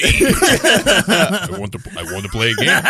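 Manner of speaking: hammed-up, loud movie-villain line; lightly put-on villain voice through laughter; Jigsaw. Kid in a mask at the theater, mock villain voice. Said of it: "I had a jigsaw mask".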